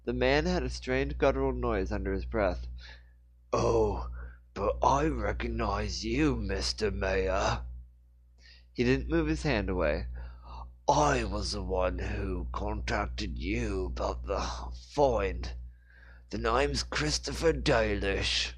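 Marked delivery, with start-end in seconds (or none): 3.5-7.6 put-on slow thick Cockney old man; slow Cockney, knowing and sly; Cockney accent. A narrator's reading. Dalish character voice. 10.9-18.5 put-on slow thick Cockney old man; slow Cockney, knowing and sly; Cockney accent. A narrator's reading. Dalish character voice.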